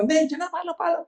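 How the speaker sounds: high, urgent coaching voice; imitated mom. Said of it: "yung narinig yung boses ng mami ko". (ginagayang nanay na nagmamadaling magturo, mataas ang boses)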